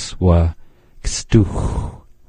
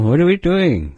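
exasperated question; 10 semitones higher than usual. Shown as a demonstration. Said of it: "You might ask that once in a while".

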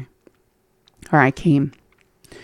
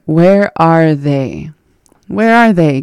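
ordinary talk

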